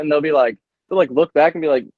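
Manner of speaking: ordinary talk, nothing marked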